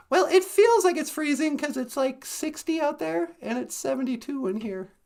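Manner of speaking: high squeaky cartoon voice; a small puppet, chatty and high. Puppet voice explains.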